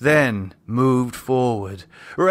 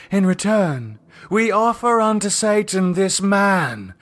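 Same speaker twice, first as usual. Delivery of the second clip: cult leader Lydia; 5 semitones higher than usual. Acted. Cult leader addressing the circle, solemn and raised. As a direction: intone it like a high priestess.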